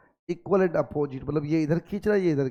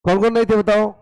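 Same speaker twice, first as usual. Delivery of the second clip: loud demand to the class, much louder; calling out; 4 semitones higher, 8 dB louder, faster than usual. Real, not acted. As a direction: call out to the whole room for an answer. Teacher demands answer from class.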